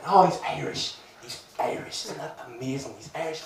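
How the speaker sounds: very soft mock-awed murmur; hushed admiration. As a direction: imitate onlookers marvelling under their breath. Admiring onlookers talk about him.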